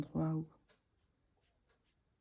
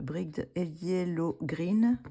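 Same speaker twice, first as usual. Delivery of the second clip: French-accented English; 6 dB louder, much slower than usual. Real, not acted. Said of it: "avec mon accent".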